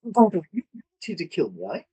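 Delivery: high, anxious elf voice; wary elf. Acted. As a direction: check nervously if they came to kill. Elf asks player character.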